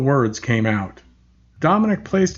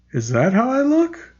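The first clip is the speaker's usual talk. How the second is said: put-on childlike wonder; 8 semitones higher than usual. Puzzled cherubic smile.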